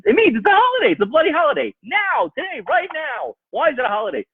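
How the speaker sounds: fervent, high-pitched emphasis; excited insistence. Real, not acted. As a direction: drive it home with rising excitement. Emphatic rebuttal in sermon.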